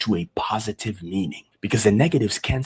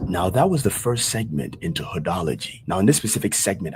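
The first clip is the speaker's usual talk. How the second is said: usual speaking voice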